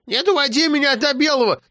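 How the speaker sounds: put-on high, scolding old woman; grandma scolding the grandson; stern grandma. A narrator's reading. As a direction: scold the boy from above.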